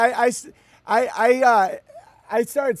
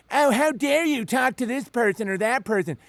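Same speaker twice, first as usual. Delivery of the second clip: mocking scold; 6 semitones higher than usual. Mocking judgmental church people.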